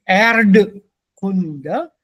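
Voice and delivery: Erdkunde with the R and a voiced D; over-articulated, incorrect Erdkunde; wrong pronunciation. Shown as a demonstration. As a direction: say Erdkunde slowly, sounding out R and D. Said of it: "da sagen wir nicht".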